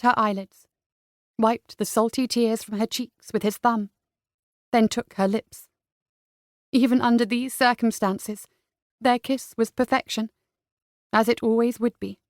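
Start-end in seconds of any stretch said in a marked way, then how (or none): none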